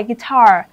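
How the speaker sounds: "guitar" as gui-TAR, second syllable loud and falling; stress on second syllable. Shown as a demonstration. Teacher drilling word stress for the class, gui-TAR punched. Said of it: "âm thứ hai".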